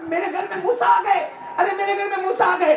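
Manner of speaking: very high, loud, excited cry; overjoyed amazement. Retold or imitated. Butcher discovering Musa has come to his house, amazed shouting.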